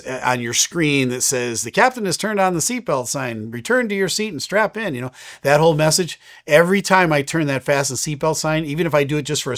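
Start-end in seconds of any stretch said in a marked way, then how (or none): 1.6-4.9 higher, imitating a recorded cabin announcement; automated announcement voice. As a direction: read it like the seatbelt chime message. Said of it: "that automated voice".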